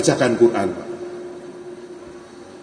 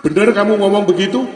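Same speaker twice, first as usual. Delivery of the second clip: higher, fast shocked question; surprised Umar; 6 semitones higher, faster than usual. Acted. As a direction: demand it in disbelief.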